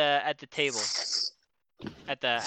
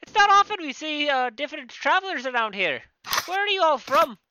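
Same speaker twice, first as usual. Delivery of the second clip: high innkeeper voice; 7 semitones higher than usual. Acted.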